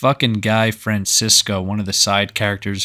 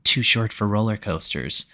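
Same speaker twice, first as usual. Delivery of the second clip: softer echo of a retold jab; sister chiming in; 2 semitones lower, 7 dB quieter than usual. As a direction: chime in quietly as the sidekick. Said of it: "the other sister chimes in".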